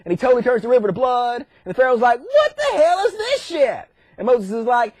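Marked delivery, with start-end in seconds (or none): 2.2-3.9 high, slow, appalled Pharaoh; mock outrage. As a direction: stretch out a hammed-up cry of disgust. Pharaoh sees river of blood.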